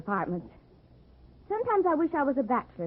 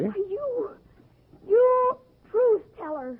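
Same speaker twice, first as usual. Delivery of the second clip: higher, louder, slow indignant sputter; outraged sputter; 10 semitones higher, 4 dB louder, slower than usual. Acted. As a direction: sputter at him, furious at being tricked. Outraged after being disarmed.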